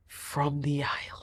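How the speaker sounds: breathy whispered ending; dramatic whisper. Acted. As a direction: drop to a hushed whisper for the reveal. Dramatic whisper of being dumped.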